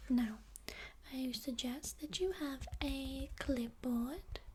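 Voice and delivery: ordinary talk, nothing marked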